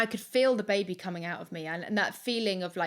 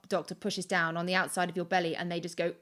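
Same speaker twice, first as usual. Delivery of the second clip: ordinary delivery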